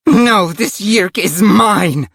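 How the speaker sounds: high, slow, furious claim; raging yell. A narrator's reading. Rachel claims the kill, enraged. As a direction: claim the enemy in a drawn-out rage. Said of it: "yelled in a blinding rage".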